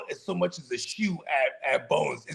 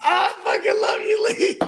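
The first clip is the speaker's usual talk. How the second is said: laughing shout; 12 semitones higher than usual. Real, not acted. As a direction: yell it through laughter. Laughing delight at friend.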